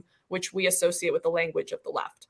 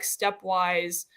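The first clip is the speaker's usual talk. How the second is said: normal talking voice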